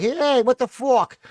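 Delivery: high, drawn-out New York holler; drunken New York accent. Acted. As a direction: let a thick New York accent burst out. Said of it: "no ability to control the New York accent".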